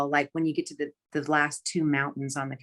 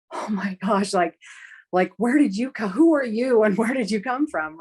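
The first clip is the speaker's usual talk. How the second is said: astonished; 6 semitones higher, 5 dB louder than usual. Retold or imitated. Retold shock at stranger's offer.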